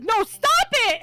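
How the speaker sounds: high, loud playful scolding; mock protest. Imitating a friend rejecting the self-put-down, high playful protest.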